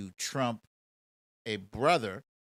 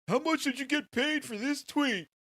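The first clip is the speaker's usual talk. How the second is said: high, louder mock of a troll; 14 semitones higher, 6 dB louder than usual. Host mimics a troll's reply, mocking high voice. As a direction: sneer it like a dumb troll.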